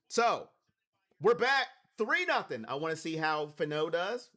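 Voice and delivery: ordinary talk, nothing marked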